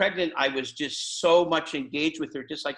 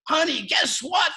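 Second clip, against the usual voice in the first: high, loud, drawn-out shout of big news; re-enacted excited yell, pitched way up; excited scream; 12 semitones higher, 5 dB louder, slower than usual. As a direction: holler it to your wife from the door. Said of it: "I scream at my wife".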